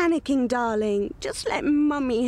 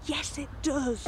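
hushed prompt; 6 dB quieter than usual. Acted. Mother quietly correcting son's answer.

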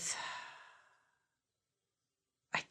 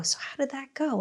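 coworkers teasing; 9 semitones higher, 6 dB quieter than usual. Retold or imitated. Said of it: "they go".

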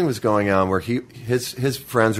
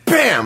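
higher, louder sudden burst; mock spit sound; 10 semitones higher, 4 dB louder than usual. Pinned kid spitting in the bully's face, mock burst. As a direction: punch out the spit noise. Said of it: "I just fucking go".

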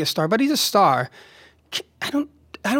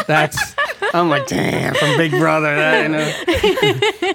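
laughing; much slower than usual. Real, not acted. Laughing at recognizing reality stars.

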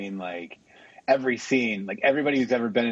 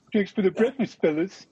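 higher, put-on comedian voice; Adam Sandler; 7 semitones higher than usual. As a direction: imitate a goofy movie-comedy voice, pitched up. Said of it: "he's doing an Adam Sandler voice".

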